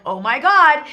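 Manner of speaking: hammed-up dismay; imitated freak-out. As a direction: imitate people panicking. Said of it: "got people freaking out".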